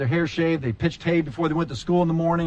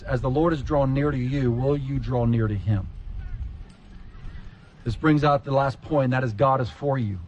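usual speaking voice

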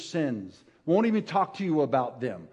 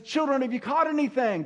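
calling out; 8 semitones higher than usual. Acted. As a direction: call across the water. Jesus calling from shore again.

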